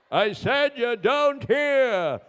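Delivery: high, slow, spelled-out repeat; emphatic doctor. Acted. Doctor repeating to the deaf patient, slow and emphatic. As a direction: say it slowly for deaf ears.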